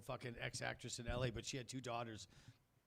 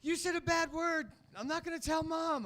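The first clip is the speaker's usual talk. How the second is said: put-on kid yelling; 7 dB louder than usual. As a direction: yell it like a tattling little boy. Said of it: "And then he yelled".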